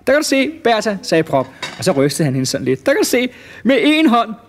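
high, gleeful showing-off; triumphant boast. Acted. Prop lifts Bertha with one hand, high gleeful boast. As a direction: crow proudly as you show off. Boasts while lifting her up.

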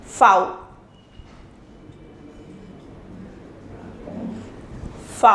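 V said as fow; German name of the letter V. Shown as a demonstration. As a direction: model V for students. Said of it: "in German it is pronounced as".

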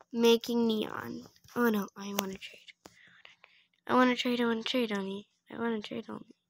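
very soft, quick half-whispered mutter. Real, not acted. Player murmuring to self about a trade, muttering. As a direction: repeat it under your breath.

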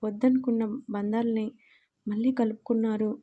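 ordinary delivery

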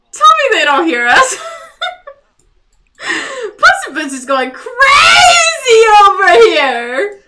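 high, loud laughing squeal; excited squeal. Real, not acted. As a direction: shriek with glee.

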